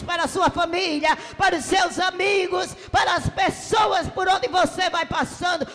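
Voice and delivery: ordinary talk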